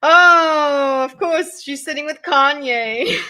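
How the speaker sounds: loud, high, drawn-out mock realization; exaggerated knowing jeer; mocking joke. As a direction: play up a sarcastic "of course". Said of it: "this is the joke people were making".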